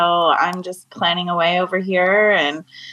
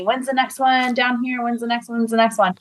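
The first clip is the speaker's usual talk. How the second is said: higher-pitched imitation of pestering fans; eager fans asking; 5 semitones higher than usual. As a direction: mimic people asking over and over. Imitating people repeatedly asking her.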